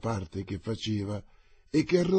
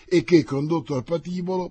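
urgent; 7 semitones higher, 8 dB louder than usual. Acted. La regina incalza emozionata.

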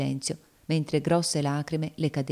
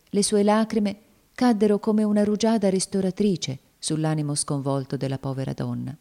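ordinary delivery

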